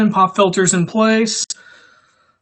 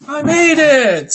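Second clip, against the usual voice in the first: higher, louder, slow mock announcement; playful higher voice for a friend's line; quoting Sammy; 10 semitones higher, 7 dB louder, slower than usual. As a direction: read out Sammy's message a bit hammed-up. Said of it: "Sammy says".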